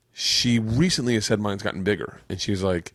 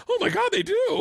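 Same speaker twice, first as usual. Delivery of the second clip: amazed. Retold or imitated. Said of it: "and he goes".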